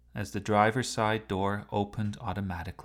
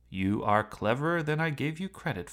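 pleased; 6 semitones higher, 3 dB louder than usual. A narrator's reading. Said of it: "It sounded pleased".

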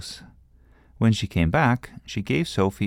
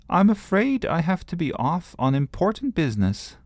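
high, grand little princess voice; cartoon princess; 11 semitones higher than usual. A narrator's reading. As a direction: play a girl putting on royal airs. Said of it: "Sophie decided that she would speak like a princess".

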